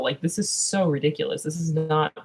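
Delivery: normal talking voice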